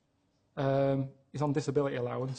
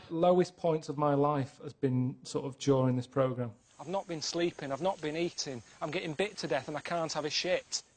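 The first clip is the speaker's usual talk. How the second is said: ordinary talk, nothing marked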